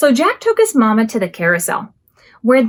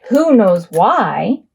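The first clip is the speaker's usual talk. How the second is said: slow suspense; 4 semitones lower, much slower than usual. Acted. Suspense question to children.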